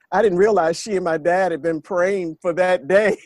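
ordinary talk

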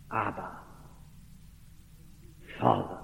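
hushed cry. Acted. Preacher voicing the cry welling up, hushed and low. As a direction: let it come up from deep inside, faint. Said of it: "the cry comes welling up".

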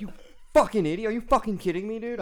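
high, loud outraged yell, retold; retold screaming. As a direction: re-enact screaming at another driver. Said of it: "I was screaming at this guy".

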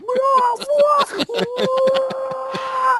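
high, loud laughing shout; shrieking laugh. Real, not acted. Laughing at the cartoon.

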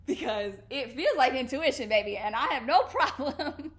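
loud, amused, breaking into a laugh; loud sassy delivery through laughter; laughing declaration. Real, not acted. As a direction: declare it boldly with a laugh bubbling up.

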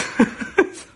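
bursts into a high airy laugh; a quick unvoiced giggle; short real giggle at her own fright; high breathy laugh. Real, not acted.

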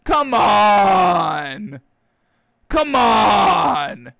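loud, drawn-out plea of disbelief; exasperated shout. Acted. Host yelling at the quarterback, exasperated shout. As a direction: yell it in frustration at him.

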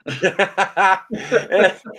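big high laugh bursting out; loud burst of laughter. Real, not acted. Host laughing hard at the Texan guest's line, loud burst of laughter. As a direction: crack up loudly at the joke.

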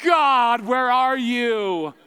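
loud, high, drawn-out retold outburst; angry cry to God. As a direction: demand it of God, loud and stretched out. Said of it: "I am angry. I'm like".

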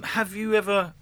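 ordinary delivery

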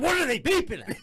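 high, fed-up imitation; mock exasperation. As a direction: squawk it in mock outrage, laughing after. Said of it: "had enough of the beeping".

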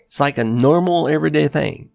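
normal talking voice

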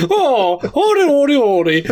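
high sing-song mock Irish trill; laughing high made-up Irish warble; mock Irish lilt. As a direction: skip through it like a leprechaun. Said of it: "not saying that's an Irish accent".

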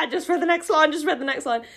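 high, giggly urging; laughing self-coaching. Real, not acted. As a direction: urge yourself on through laughter. Urges self while cracking up.

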